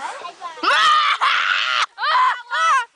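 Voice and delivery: long, drawn-out put-on laugh; exaggerated staged laughing; show-off laugh. Shown as a demonstration. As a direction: perform a laugh for the camera. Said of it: "And this is my laugh".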